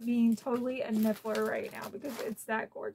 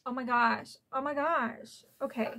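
ordinary talk

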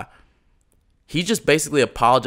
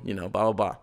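ordinary talk

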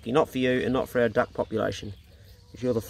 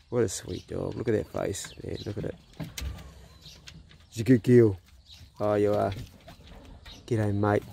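lower, softer praise to the dog; soft cooing; 5 semitones lower, 5 dB quieter than usual. Real, not acted. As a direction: coo gently at the dog's face.

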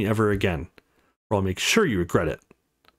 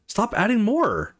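pitched way up, slow and insistent; high pleading voice; 11 semitones higher, slower than usual. Acted. Nami begs him to stop taking on more, high and pleading. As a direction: beg him to stop, stretching the words.

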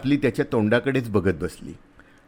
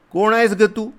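high, louder gruff soldier question; a rough soldier demanding who she is; soldier asking; 11 semitones higher, 4 dB louder than usual. A narrator's reading.

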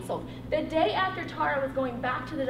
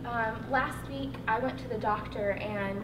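low, soft, sombre retelling; subdued confession; 5 semitones lower, 6 dB quieter than usual. Retold or imitated. Woman telling Holly about her cancer, subdued. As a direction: lower the voice and give it quietly.

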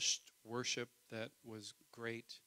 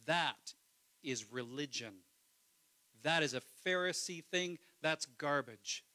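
loud, passionate preacher voice; preacher at full force; preaching; 10 dB louder than usual. Acted. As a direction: preach it hard to the congregation.

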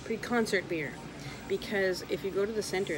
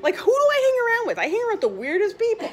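high, loud, fast and laughing; exasperated yelling; 12 semitones higher, 6 dB louder, faster than usual. Real, not acted. Complaining about her weird friends, exasperated yelling. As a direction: rattle it off in comic disbelief.

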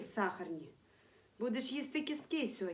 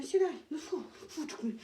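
ordinary delivery

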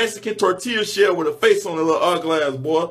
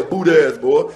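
ordinary delivery

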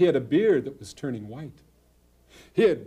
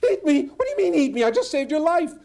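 high, loud squeaky disbelief; a small animal crying out that it isn't fair; shocked squirrel; 12 semitones higher, 5 dB louder than usual. Acted.

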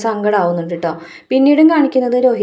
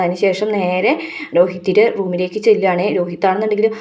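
ordinary talk

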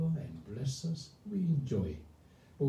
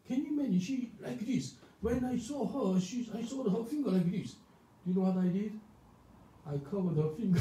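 ordinary delivery

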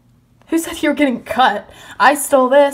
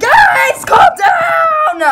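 high, loud plea; shouting over a fight; 13 semitones higher, 4 dB louder, much slower than usual. Acted. Girl tells fighting friends to calm down, shrill shout. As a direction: yell to break up the brawl.

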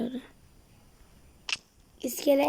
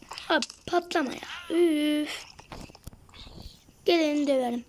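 ordinary delivery

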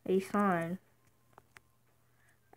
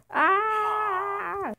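long, high, loud scream; 11 semitones higher, 9 dB louder than usual. Acted. Scared player cries out in the game, screaming.

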